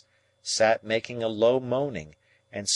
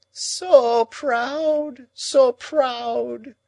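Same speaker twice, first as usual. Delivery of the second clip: moaning; 7 dB louder, much slower than usual. A narrator's reading. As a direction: moan it in wounded grief. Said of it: "moaned Miss Havisham".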